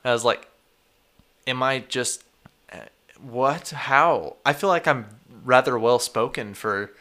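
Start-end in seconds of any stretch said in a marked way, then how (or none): none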